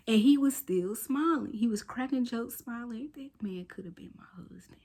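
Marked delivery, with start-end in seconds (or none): 3.2-4.8 breathy secret aside; whispered joke. Real, not acted.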